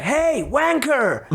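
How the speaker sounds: high, slow drawn-out jeer of a local; a stranger calling out a mocking insult; stretched-out taunting yell; mock heckle.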